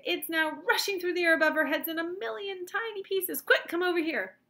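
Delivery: high, loud urgent commentary; excited cry. A narrator's reading. Wonka tracking pieces through the air, excited cry. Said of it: "cried Mister Wonka".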